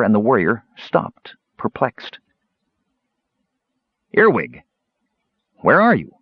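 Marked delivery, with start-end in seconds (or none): 4.1-6.1 louder call into the dark. A narrator's reading. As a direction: call out for a lost friend. Warrior calls out in dark.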